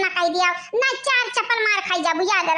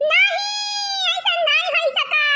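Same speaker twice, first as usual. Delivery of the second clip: horrified protest; 7 semitones higher than usual. Acted. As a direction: cry out in dismay. (घबराकर ऊँची आवाज़ में नहीं कहना)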